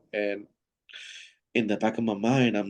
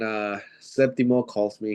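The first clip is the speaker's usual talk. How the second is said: ordinary talk, nothing marked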